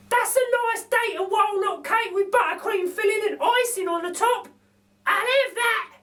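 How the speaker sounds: a hidden voice drooling over the food; mystery voice. A narrator's reading. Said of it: "The voice says".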